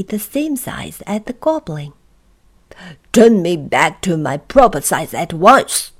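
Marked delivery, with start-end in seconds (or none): 3.1-5.9 loud, outraged demand; loud, indignant rage; spluttering rage. Acted. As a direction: demand it loudly, spluttering with fury. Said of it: "spluttered Mister Uppity in a rage".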